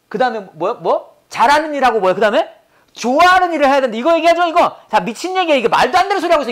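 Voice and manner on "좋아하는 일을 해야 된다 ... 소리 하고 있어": irritated rant, higher pitched; exasperated, raised-pitch tirade; worked up. Real, not acted. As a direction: scoff at the idea with real annoyance. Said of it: "이거 진짜 승질나게".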